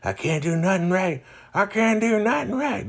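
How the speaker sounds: hammed-up self-pity rising to a high wail; mock despair. Voicing Derek hitting his head, hammed-up despairing wail. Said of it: "hitting himself on the head like".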